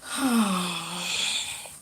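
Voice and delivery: long, airy exhale; long sigh. Real, not acted. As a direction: let out a real, drawn-out sigh. Sighs on returning to the stream.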